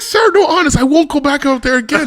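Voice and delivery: high, loud mock-desperate promise; retold pleading. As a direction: beg frantically as if caught out. Begging the officer.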